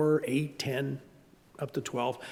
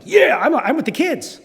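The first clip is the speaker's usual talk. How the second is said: higher, louder cheer; 8 semitones higher, 5 dB louder than usual. Retold or imitated. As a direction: grandpa celebrating a Mario win. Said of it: "I'm like".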